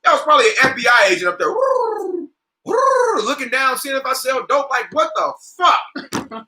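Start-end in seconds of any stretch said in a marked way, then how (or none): 1.5-3.2 high falsetto owl hoot; mock hooting. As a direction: hoot it high like a night bird. Mimics the bird in tree.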